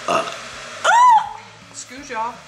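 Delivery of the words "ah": short high startled shriek; sudden shriek. Real, not acted. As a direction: yelp out loud, caught off guard. Sudden outburst mid-sentence.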